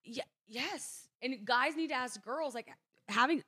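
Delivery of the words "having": usual speaking voice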